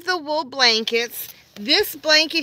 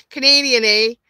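mock Canadian accent; 6 dB louder than usual. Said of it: "[name] is full-on Canadian".

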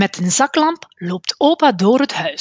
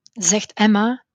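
usual speaking voice

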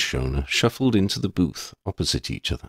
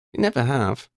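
put-on higher young voice, dry and fond; girl's voice, amused then serious; Lydia, wry schoolgirl; 7 semitones higher than usual. A narrator's reading. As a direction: tease her friend, then own up frankly. Exchange between friends.

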